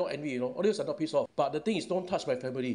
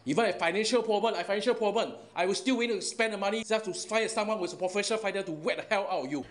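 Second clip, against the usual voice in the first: high-pitched furious vow; angry vow; 7 semitones higher than usual. Real, not acted. Vowing to hire a fighter against the troll, high and furious. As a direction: swear it through rising anger. Heated rant at online troll.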